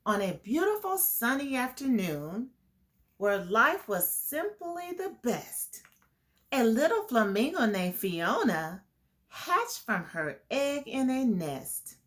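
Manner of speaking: put-on reading a picture book to kids; lilting storybook read; story narration. A narrator's reading. Teacher reading the flamingo story to the class, lilting. Reads rhyming story aloud.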